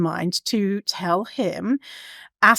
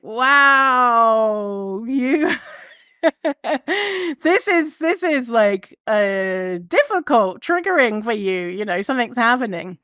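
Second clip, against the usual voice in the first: amazed self-observation; 3 semitones higher, slower than usual. Retold or imitated. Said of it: "observing what I was doing".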